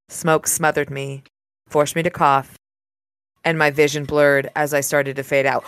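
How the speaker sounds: low, even audiobook reading; story narration. A narrator's reading. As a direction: read the passage like a novel narrator. Reads the novel aloud.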